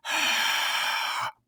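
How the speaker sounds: long imitated exhale; airy huff, as if fogging a window; breath blown on glass. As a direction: blow out a slow, soft breath. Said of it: "blowing on it".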